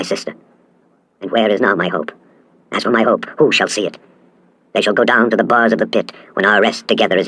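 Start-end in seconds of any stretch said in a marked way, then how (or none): none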